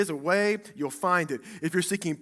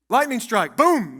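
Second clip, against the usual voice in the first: mock lightning strike; 5 semitones higher, 10 dB louder than usual. Mock thunderbolt after rebuke.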